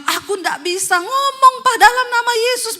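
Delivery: high, loud strained voice; struggling husband. Retold or imitated. Husband straining, cannot speak the name, high strained. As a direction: force the words out like they won't come.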